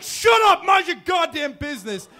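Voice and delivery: high, loud shout; mayor dad screaming at the parents, loud and high. Retold or imitated. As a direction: yell back like a furious dad. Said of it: "And my dad's like".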